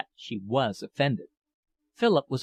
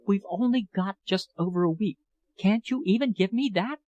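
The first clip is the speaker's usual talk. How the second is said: higher, tearful pleading woman's voice; pleading older lover; 6 semitones higher than usual. A narrator's reading. Older woman begs departing young lover, higher and pleading. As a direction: beg him not to leave, voice lifted and aching. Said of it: "she berates him tearfully".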